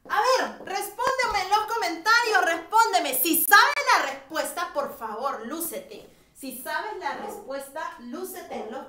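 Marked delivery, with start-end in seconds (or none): 0.1-4.7 loud, high call to answer in the comments; rally the class like a cheering coach. Real, not acted. Teacher calling out to online students, loud and high.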